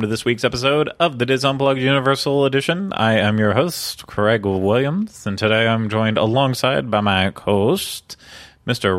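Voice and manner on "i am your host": usual speaking voice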